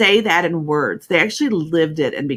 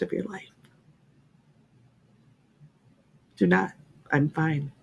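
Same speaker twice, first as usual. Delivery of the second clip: gentle reassurance; 3 dB quieter than usual. Retold or imitated. Retold call forgiving young driver.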